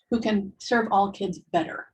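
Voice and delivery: normal talking voice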